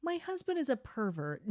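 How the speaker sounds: mock disgusted wife. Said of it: "Dina looks disgusted and says".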